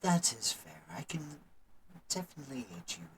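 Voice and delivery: very soft, subdued priest, agreeing to help; soft-spoken temple priest. Acted. Priest agrees to help with the mending, soft-spoken.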